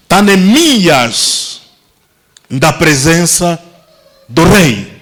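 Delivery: drawn-out, deliberate preaching; lingering on each phrase; slow dramatic telling. Acted. Preacher slowing to paint the scene, very slow.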